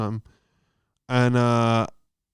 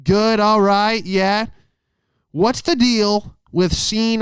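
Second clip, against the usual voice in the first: much higher, slow, hammed-up emcee voice; stand-up opener; 9 semitones higher, slower than usual. Comic performing at the judge's grave, hammy stage voice. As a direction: greet the crowd like a cheesy club comic.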